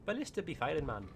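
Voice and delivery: high, badly faked Irish lilt; terrible Irish accent. Shown as a demonstration. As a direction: have a poor go at an Irish accent. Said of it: "That was terrible".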